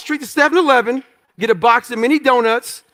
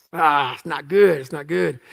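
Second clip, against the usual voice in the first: dismayed; 7 semitones lower, 4 dB quieter than usual. Retold or imitated. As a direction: sink into quiet dread at the news. Hears he must return to training.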